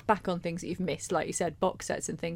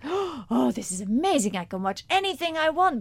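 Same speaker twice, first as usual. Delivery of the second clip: high excited put-on voice; breathless mock delight; 5 semitones higher than usual. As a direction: gush it like an overexcited girl. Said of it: "every time you do anything like that, that voice comes out".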